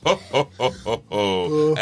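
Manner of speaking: slow jolly ho ho ho like Santa; slow hearty Santa ho ho; Santa Claus laugh. Shown as a demonstration. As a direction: laugh like Father Christmas, slowly. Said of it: "That's the Santa Claus laugh".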